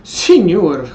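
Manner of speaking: ordinary talk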